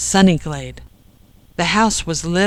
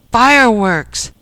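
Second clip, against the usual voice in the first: louder, higher, drawn-out exclamation; exclaiming; 5 semitones higher, 6 dB louder than usual. A narrator's reading. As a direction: call it out slowly like a sudden answer. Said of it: "exclaimed Ken and Jack".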